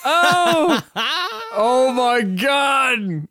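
high, slow, drawn-out wail of despair; mock anguished wail. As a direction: wail it like tragedy struck. Mock horror at friend's gap.

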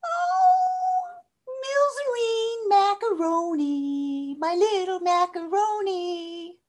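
very high, slow doting pet voice; sing-song high baby talk to an animal. Shown as a demonstration. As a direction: coo at your little dog. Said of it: "use the tone of voice you use with your animal".

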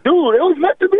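thrilled it worked out; excited realization. Retold or imitated. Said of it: "I was like".

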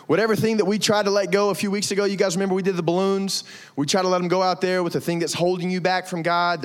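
normal talking voice

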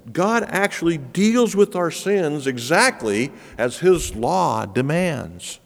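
ordinary talk, nothing marked

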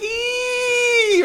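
very high, shrill-free cartoon squeal; mock squeal. Stuffed bunny flying into the fire, high cartoon squeal. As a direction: yelp as the bunny flies into the fire.

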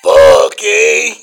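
loud, drunken-sounding exclamation in a put-on voice; Chris Red voice. Co-host showing off his Chris Red voice, loud and drunk-sounding. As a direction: shout it out like a boozy hype man. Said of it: "Why do you sound drunk?".

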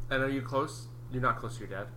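normal talking voice

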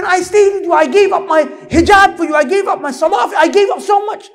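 high, loud anguished pleading; crying woman's protest. Acted. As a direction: act out a woman wailing at abandonment. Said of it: "the woman is crying".

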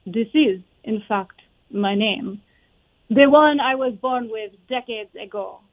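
Marie Curie lines in a French-Polish accent. Shown as a demonstration. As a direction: speak English with a Polish accent and a French tint. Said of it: "I tried my best to do a Polish accent".

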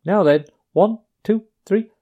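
small voice counting in the carol. A narrator's reading. Mouse leader counting off carol.

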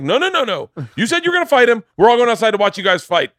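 high, loud, pushy club manager; eager insistence. Retold or imitated. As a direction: egg them on to fight. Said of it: "And Paul's like".

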